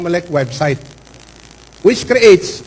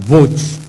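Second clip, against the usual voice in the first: "votes." set apart after a pause and hit firmly; stress on votes; 4 semitones lower than usual. Shown as a demonstration. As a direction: lean on "votes." as its own word. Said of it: "I'd like to emphasize the word".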